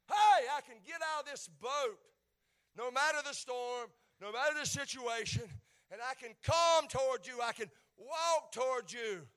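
loud, rising Peter's resolve; bold declaration. Acted. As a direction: declare it with courage. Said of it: "he said".

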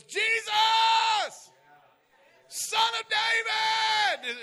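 very high, loud, slow ringing call of the name; faith cry. Retold or imitated. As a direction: shout it out in bold faith. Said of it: "but then there's a faith cry".

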